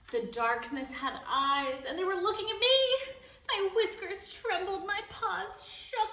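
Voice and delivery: scared build. A narrator's reading. Cat narrator trembling as eyes stare from darkness. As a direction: let the fear rise as the bear appears. Frightening climax of story.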